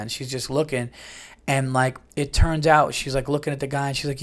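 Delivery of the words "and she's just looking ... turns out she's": ordinary delivery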